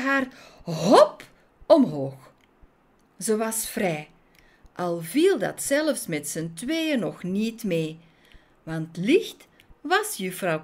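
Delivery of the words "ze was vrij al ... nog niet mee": plain reading voice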